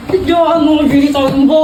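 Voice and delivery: half-crying, half-laughing yell of "Joanna, bilisan mo!"; strained, pained shout of "bilisan mo"; desperate plea. Acted. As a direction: beg through a laugh-sob for "Joanna" to hurry. Desperate, can't hold it anymore.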